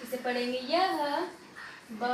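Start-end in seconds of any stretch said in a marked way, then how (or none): none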